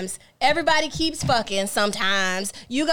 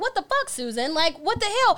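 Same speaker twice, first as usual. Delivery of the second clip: indignant; 8 semitones higher than usual. Retold or imitated. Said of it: "And I'm like".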